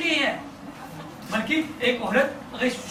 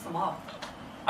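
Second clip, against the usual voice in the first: breathy dramatic hush; 9 semitones lower, 14 dB quieter, much slower than usual. Acted. Dramatic fading final word.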